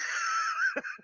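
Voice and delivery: very high airy squeal; a short breathless shriek; high squeal of laughter. Real, not acted. Streamer cracking up at chat, high squeal of laughter. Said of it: "It hurts".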